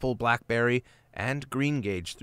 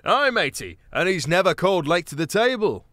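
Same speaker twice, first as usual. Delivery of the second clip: higher, louder cheeky rogue voice; a jolly mouse thief teasing; called out; 7 semitones higher, 7 dB louder than usual. A narrator's reading. Gonf calls out teasing Martin, louder. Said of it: "called out".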